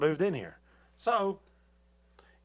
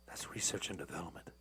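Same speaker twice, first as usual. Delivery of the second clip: whispered aside; 8 semitones lower, 12 dB quieter than usual. Real, not acted. Aside explaining abbreviation.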